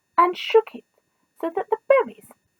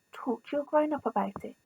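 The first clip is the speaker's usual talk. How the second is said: much softer, gentle aside; 13 dB quieter, faster than usual. Real, not acted. Reader quietly telling the child to ask their grown-up. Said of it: "aside to child".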